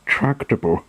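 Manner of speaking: plain reading voice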